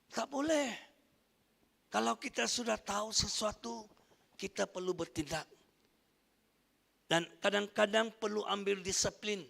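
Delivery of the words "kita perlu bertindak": ordinary delivery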